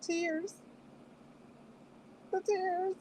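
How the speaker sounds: high, soft mock sob over tears; high playful mock-weepy "tears"; mock tearful. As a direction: lift it high as if welling up. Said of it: "I was like".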